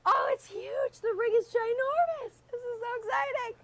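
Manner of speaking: high squeal of excitement; shrieking jackpot winner. Real, not acted. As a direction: shriek it like you won. Reporter breaking [name] engagement.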